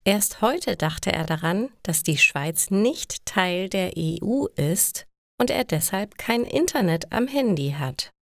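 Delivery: usual speaking voice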